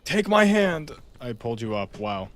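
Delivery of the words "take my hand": heroic rescuer call. Pretend. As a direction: call down to save them, big and slow. Pulling teammate up a ledge.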